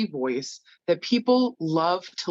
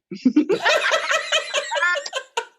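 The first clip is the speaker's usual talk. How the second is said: high burst of real laughter; big laugh. Real, not acted. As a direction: let the laugh break out high. Laughs at her own retort.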